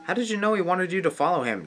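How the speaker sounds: normal talking voice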